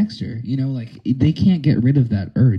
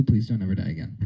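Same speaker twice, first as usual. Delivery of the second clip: normal talking voice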